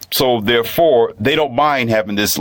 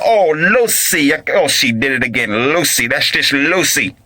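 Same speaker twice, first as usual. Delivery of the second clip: exasperated husband; 5 semitones higher than usual. Retold or imitated. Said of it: "he'd be like".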